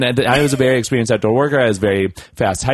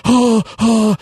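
high, louder startled oh oh; mock flustered campers caught without paying; 13 semitones higher, 6 dB louder than usual. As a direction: sound startled and cornered.

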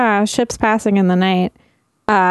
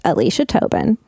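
usual speaking voice

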